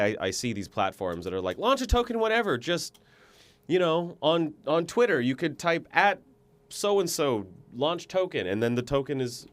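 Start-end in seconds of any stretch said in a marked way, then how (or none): 1.6-8.5 mock hype pitch. Mocking crypto platform pitch.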